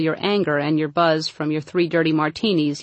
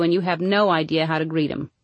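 ordinary talk, nothing marked

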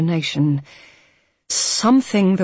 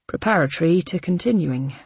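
plain reading voice

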